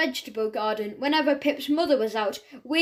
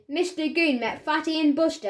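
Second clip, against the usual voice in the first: usual speaking voice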